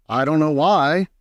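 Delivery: plain reading voice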